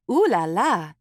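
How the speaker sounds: ordinary delivery, nothing marked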